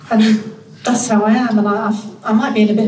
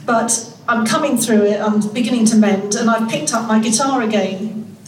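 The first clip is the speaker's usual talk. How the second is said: ordinary talk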